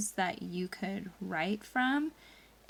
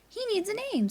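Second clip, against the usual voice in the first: mom's high voice; 13 semitones higher than usual. Acted. Mom character voiced.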